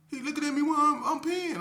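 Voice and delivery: high, loud, indignant inmate; a jittery cellmate squealing his complaint; voiced cellmate's outrage. Imitating the cellmate telling him he got stared at while peeing.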